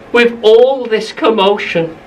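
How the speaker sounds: ordinary delivery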